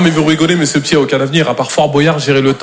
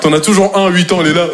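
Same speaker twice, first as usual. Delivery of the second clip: ordinary delivery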